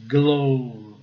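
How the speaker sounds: "glow" with a long drawn O; "glow" stretched out, rhyming with "go"; rule-based mispronunciation. Shown as a demonstration. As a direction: say "glow" loud, with an OH vowel. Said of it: "A pronúncia seria glove".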